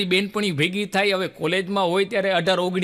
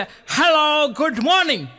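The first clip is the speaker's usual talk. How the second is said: foreigner's greeting; 8 semitones higher, 3 dB louder than usual. Retold or imitated. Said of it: "ઓલો ભૂરીઓ બોલે".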